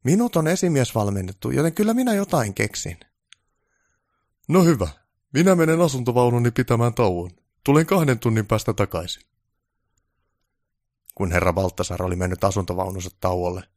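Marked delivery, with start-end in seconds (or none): 0.0-3.0 high, louder keen reply; eager to please; keen assistant voice; eager helper. Acted. Onni proud of training. 4.5-9.2 higher, louder haughty question; self-important director voice; pompous director. Acted. Director leaves for break.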